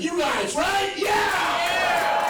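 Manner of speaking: very high, drawn-out cheer; rallying the crowd. Real, not acted. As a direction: whoop to fire up the audience. Whips up the audience.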